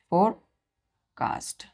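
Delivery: Forecast stretched out slowly; slow teaching demo. Shown as a demonstration. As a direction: drag out forecast for the class. Teacher drawing out the word. (धीरे-धीरे forecast)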